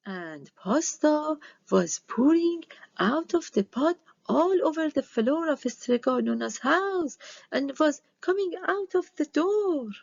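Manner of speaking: ordinary delivery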